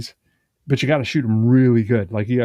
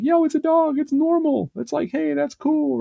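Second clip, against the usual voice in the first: much higher, eager and delighted; excited. Retold or imitated. Host imagining meeting a dog after the apocalypse, thrilled. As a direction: squeal with joy at seeing a dog. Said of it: "I'd be like".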